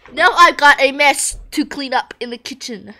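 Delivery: annoyed rant. Real, not acted. Annoyed at kitchen mess.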